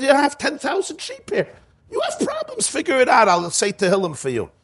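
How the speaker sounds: high, brushing-off voice; dismissive Moshe. Retold or imitated. Moshe brushes off the sheep, high and dismissive. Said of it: "Moshe should have said".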